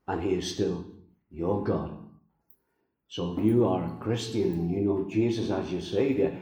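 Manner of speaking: ordinary talk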